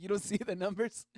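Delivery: high, loud disbelieving question; can't believe she misses it; incredulous. Retold or imitated. Amazed she sees nothing.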